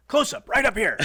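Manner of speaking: loud, high barked order; imitated wild man. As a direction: yell it like a manic director. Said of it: "he was just a wild man".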